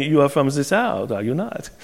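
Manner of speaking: put-on German accent. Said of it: "in some strange German voice".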